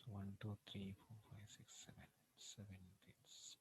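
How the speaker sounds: very soft, half-whispered counting to oneself; counting under breath. Real, not acted. Instructor tallying fields and subjects to himself, muttered under breath.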